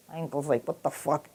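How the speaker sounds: very soft, low mock whisper; hushed. Uncle reacts to a secretive waiter, hushed and low. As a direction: drop right down to a murmur. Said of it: "he starts whispering".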